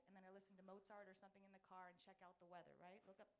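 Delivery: ordinary talk, nothing marked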